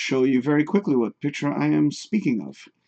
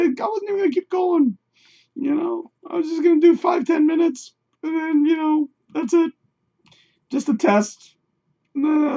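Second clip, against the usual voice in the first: high squeaky voice; 13 semitones higher than usual. Pretend. Sustained high put-on voice.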